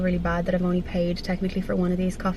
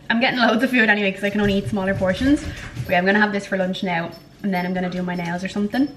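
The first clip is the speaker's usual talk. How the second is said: usual speaking voice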